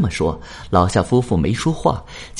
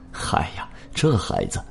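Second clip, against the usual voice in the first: dismissive chuckle; 6 semitones lower, 7 dB quieter than usual. A narrator's reading. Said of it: "吴大昌笑了笑".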